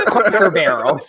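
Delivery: giggling through the words; laughing. Real, not acted. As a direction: laugh while naming it. Laughing at the joke.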